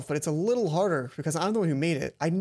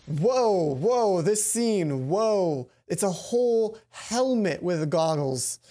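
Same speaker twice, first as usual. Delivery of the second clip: slow, louder amazed whoa; drawn-out awe; 3 dB louder, much slower than usual. Real, not acted. Player marvels at the new scene, slow and awed. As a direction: let the wonder stretch out.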